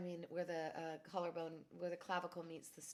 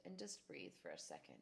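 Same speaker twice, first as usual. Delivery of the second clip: calm breathing cue; 12 semitones lower, 8 dB quieter than usual. Real, not acted. Guided breathing instruction.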